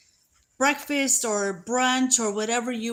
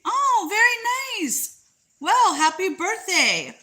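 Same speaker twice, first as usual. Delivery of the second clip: delighted; 8 semitones higher than usual. Real, not acted. Reacting to birthday news.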